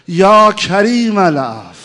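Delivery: very loud, slow invocation; loud prayer cry. Acted. Preacher crying out to God before the crowd. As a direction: cry the divine name out with all your voice.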